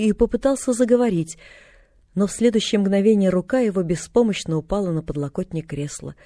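usual speaking voice

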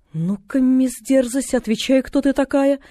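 a hint of a slow, bossy sick woman; stern invalid. A narrator's reading. Mrs Snow questioning the girl, lightly bossy. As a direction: demand it slowly, lightly voiced. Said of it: "спросила миссис Сноу".

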